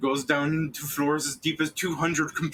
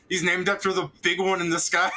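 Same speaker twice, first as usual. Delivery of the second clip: higher, breaking into laughter; proud answer, laughing; laughing dwarf; 7 semitones higher than usual. Retold or imitated. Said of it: "He goes".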